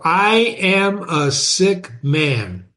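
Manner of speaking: slow, weighty delivery of the opening line; teacher savoring the novel's first line; slow reading. Shown as a demonstration. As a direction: read it slowly, letting it sink in. Teacher reads opening line slowly.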